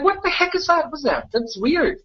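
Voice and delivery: high-pitched mock disbelief; incredulous, pitch jumping up; acted bafflement. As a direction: react as if it makes no sense at all. Acts out baffled reaction.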